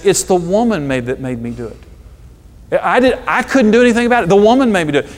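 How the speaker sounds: high, loud whiny excuse; whining Adam. Retold or imitated. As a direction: whine like a caught child shifting blame. Adam blames Eve, whiny.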